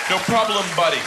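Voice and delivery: firing back with a little swagger; pointed comeback. Retold or imitated. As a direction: cashier gets his own jab back, smug. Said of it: "I even did that little head flick".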